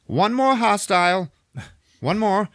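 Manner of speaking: high, loud droid alarm; droid alert. Retold or imitated. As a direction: sound the alert as a mock droid. Droid spots gunman, high and loud.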